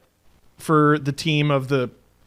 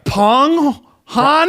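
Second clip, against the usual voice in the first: Boston accent attempt; 9 semitones higher, 5 dB louder than usual. Shown as a demonstration.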